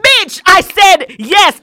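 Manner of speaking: high, loud and slow, every word hammered; furious retort. Retold or imitated. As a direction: bite the words out at the doctor. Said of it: "I'm like".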